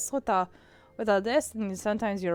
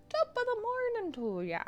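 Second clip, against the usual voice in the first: Irish accent; 11 semitones higher, 6 dB quieter than usual. Shown as a demonstration. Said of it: "Nope, that wasn't it".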